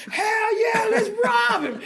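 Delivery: high, loud imitated crowd yell; rowdy crowd. Crowd answering call to rob him, imitated rowdy shout. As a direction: holler it like an egged-on audience. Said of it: "And the crowd said".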